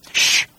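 insistent voiceless shush; hushing "shh". Acted. Ford hushing Arthur while watching, insistent shush. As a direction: shush them firmly.

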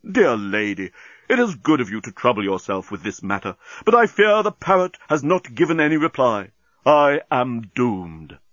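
formal lament slowing at the end; grave courtesy, stretching the final words; doleful Bolfas. Acted. Bolfas telling Nettie he is doomed, doleful.

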